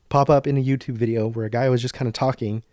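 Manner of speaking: ordinary talk, nothing marked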